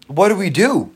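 high, loud frightened earthworm; panicked cry. A narrator's reading. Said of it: "cried the earthworm".

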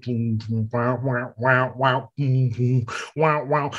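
imitating a cheesy porno soundtrack; mock music beat. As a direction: chant the bass line and wah-wah. Imitating porn music again.

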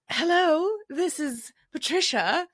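Patricia. Pretend. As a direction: pretend to be your own mom on the phone. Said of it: "let me put her on".

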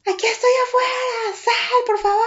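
desperate shout. Retold or imitated. Outside his house, begging the ex to come out: desperate shouting. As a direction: scream it up at the house, begging him out. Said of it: "Pero gritando así de".

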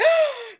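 sharp, high, loud gasp of alarm; mock horrified gasp. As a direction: gasp as if your hair is in danger. Reacting to sister's haircut offer.

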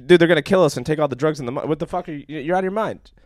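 panicked yell. Retold or imitated. Panicked yelling at dealer with gun.

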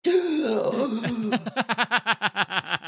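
gasp, then crack up; gasp with a laugh. Shown as a demonstration. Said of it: "I want the gasp again".

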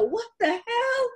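very high-pitched indignant squeal; mock exasperation. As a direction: imitate a daughter mad about her pay. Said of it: "mad as hell".

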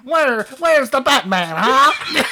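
high, drawn-out, squawky cartoon villain; penguin villain impression. Host as penguin hunting the hero, high squawky villain voice. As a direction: quack it out as a comic penguin villain.

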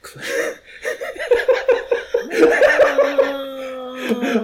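loud, high, wheezing laughing fit; uncontrollable laughter. Real, not acted. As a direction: lose it completely mid-reading. Reader cracks up during the reading.

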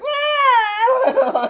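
very high, loud mock wail; hammed-up crying scream; imitated bawling. Imitating a girl crying in the theater, exaggerated bawl. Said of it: "like, bawling".

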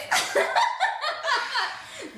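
high cackling laugh like a witch; evil witch cackle. Real, not acted. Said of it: "that sounded like an evil witch".

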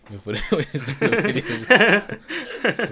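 loud laughing talk. Real, not acted. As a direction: laugh through the words. Laughing while hyping the video.

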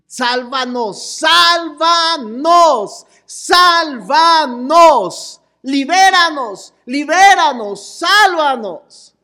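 slow, loud pleading shouts; desperate cry. Shown as a demonstration. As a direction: cry out as if begging for rescue. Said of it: "salieron gritando a voz en cuello".

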